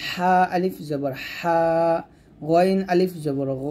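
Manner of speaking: slow drill, throaty হা and গ held long; throat letters. Shown as a demonstration. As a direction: stretch হা and গ from the throat. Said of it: "হরফ আমরা গলা থেকে".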